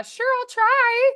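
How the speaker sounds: high, drawn-out hesitant agreement; gamely unsure. Retold or imitated. Actor asked for an unfamiliar accent at an audition, gamely unsure. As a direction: stretch it out high, willing but unprepared.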